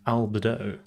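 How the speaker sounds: Albedo as AL-buh-doh; deliberate mispronunciation. Shown as a demonstration. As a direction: say Albedo with "buh" for the middle syllable. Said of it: "humor in mispronouncing things".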